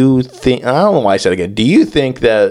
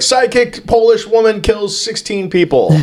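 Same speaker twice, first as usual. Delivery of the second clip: high-pitched mock headline shout-out; newspaper headline; 9 semitones higher than usual. Papers selling the psychic murder story, mock sensational. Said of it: "at this point, they're like".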